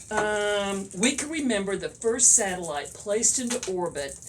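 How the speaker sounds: ordinary talk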